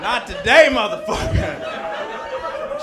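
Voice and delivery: loud yell rising to a drawn-out screech; defiant yell. Acted. Kid turning down the priest's ashes, loud defiant screech. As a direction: shout it, stretching the last word high. Six-year-old refuses priest in retelling.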